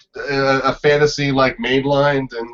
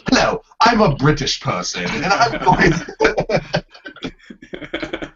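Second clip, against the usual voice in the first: mock British accent; 5 semitones higher, much slower than usual. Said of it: "What's that South Park joke".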